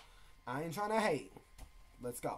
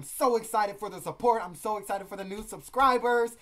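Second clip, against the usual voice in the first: louder, emphatic excitement; excited, stretching out the last phrase; 4 semitones higher, 9 dB louder than usual. Real, not acted. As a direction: gush with excitement, building louder.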